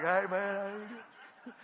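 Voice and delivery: slow, spaced-out drawl; stoned greeting. Acted. Boater on substances greeting the walker, stoned.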